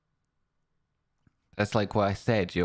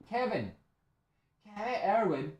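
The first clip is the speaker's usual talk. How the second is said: shrieked name, pitched way up; high excited call; 14 semitones higher, 5 dB quieter than usual. Real, not acted. Streamer calling out a viewer's name, delighted.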